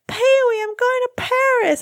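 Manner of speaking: very high, excited falsetto imitation; movie character quote. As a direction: squeal it out like the actress in the movie. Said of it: "that part where she goes".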